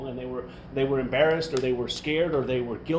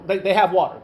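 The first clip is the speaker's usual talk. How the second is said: ordinary delivery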